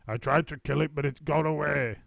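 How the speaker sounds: husband teasing in mock alarm; Mister Twit. A narrator's reading. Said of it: "Mister Twit said".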